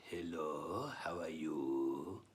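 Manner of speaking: low, soft, drawn-out Yinglish greeting; Yinglish accent. Acted. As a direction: stretch it out slowly in a deep Yiddish-English accent. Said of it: "But they don't, they go".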